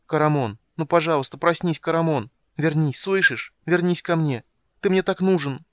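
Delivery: high, loud entreaty; urgent pleading. A narrator's reading. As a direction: implore him to wake and come back. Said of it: "просящий, ласковый, нежный".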